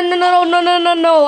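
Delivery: normal talking voice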